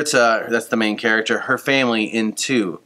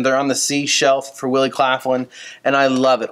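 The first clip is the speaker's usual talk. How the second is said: usual speaking voice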